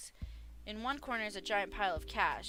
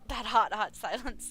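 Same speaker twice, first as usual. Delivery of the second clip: sultry drawl; 7 dB louder, slower than usual. Acted. Savoring a joke line.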